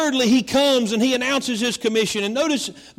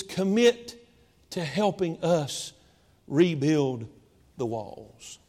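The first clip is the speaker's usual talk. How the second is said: quieter and slower, fading down; hushed plea; 6 semitones lower, 6 dB quieter, slower than usual. Acted. Nehemiah asking the people for commitment, hushed and fading. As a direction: sink the plea to almost nothing.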